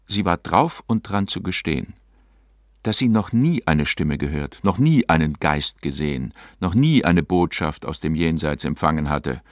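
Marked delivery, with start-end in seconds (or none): none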